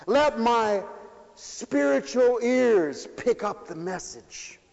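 high, loud, ringing sermon; preaching at full force. Acted. Preacher to congregation, preaching at full force.

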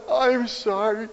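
lightly voiced little child saying sorry; a hint of a small child after crying; child's apology. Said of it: "they catch their breath and they say".

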